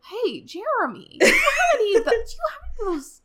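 high-pitched Minnesotan caricature; Minnesotan accent. Acted. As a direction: call out to a neighbour in a hammed-up Minnesotan accent. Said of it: "giving her a Minnesotan accent".

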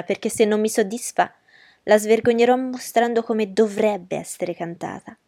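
ordinary delivery

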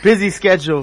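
normal talking voice

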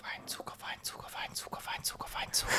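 breathy teasing whisper. Pretend. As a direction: whisper it like a secret chant. Mocking misheard word.

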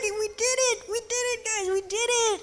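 whole team cheering together at the end of filming. Retold or imitated. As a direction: cheer like you won.

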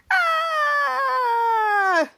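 long, high descending mock scream; imitated scream. Villains hugged while chained up, long mock scream. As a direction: hold a pretend wail, sliding down.

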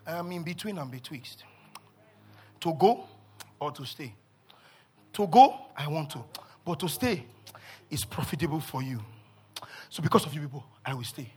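low, soft weighing of options; quiet, unhurried deliberation; casual musing on death. Acted. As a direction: imitate an apostle calmly talking over death. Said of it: "That's how Paul was talking about death".

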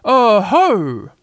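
loud, high attempt at fierceness; small creature trying to sound fierce; fierce as he can. A narrator's reading. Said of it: "as fiercely as he could".

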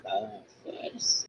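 muttered curse, slow and voiceless; cursing under breath. Real, not acted. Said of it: "tá falando baixinho". (xingando baixinho)

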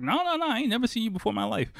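high, rapid mock denial; tumbling high-pitched protest; emphatic denial. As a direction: imitate someone rushing to deny it. Acted emphatic denial by the stranger.

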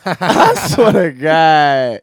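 slow words through laughter; laughing. Real, not acted. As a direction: laugh while swearing it. Laughing while joking about friends.

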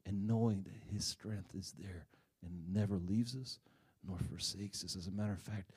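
soft, low sermon voice, measured and earnest; quiet preaching. Acted. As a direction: draw the listeners in gently. Sermon continues.

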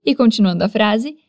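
ordinary talk

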